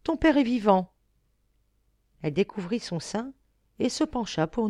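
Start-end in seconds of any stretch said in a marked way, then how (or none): 0.0-0.9 a louder, higher joyful exclamation; exclaiming. A narrator's reading. Mother to her baby, joyful exclamation. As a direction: call out to the baby in delight. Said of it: "s'écria-t-elle".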